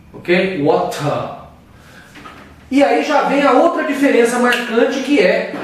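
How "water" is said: "Water" with a crisp, released T; British hard T. Shown as a demonstration. Teacher repeating the British version, crisp T in "Water". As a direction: say "Water" British-style, ending in an open vowel. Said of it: "Já o britânico fala o T".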